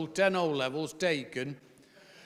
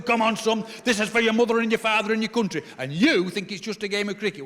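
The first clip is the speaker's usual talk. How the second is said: dad urging him on; 4 semitones higher, 5 dB louder, faster than usual. Retold or imitated. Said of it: "Heart's pumping, fists going".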